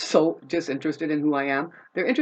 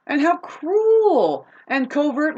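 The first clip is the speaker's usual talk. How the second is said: drawn out slowly, each word pressed; slow high emphatic complaint; slow emphatic rant; 10 semitones higher, 5 dB louder, much slower than usual. Real, not acted. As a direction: spell out their cruelty slowly and high. Ranting about cruel people.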